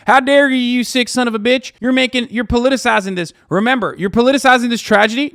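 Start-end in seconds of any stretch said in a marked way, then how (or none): 0.1-3.3 high, loud mock outrage; hammed-up fake indignation, much higher and louder; mocking Republican outrage. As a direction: shout it as a sneering parody of a Republican. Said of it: "when a fucking Republican asshat says".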